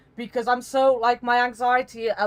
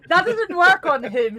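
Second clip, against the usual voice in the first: laughing; 9 semitones higher, 7 dB louder than usual. Real, not acted. Laughing at the teasing.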